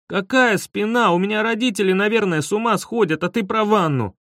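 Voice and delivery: high-pitched indignant scolding; exasperated, nervy rant; angry outburst. A narrator's reading. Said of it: "рассердился я".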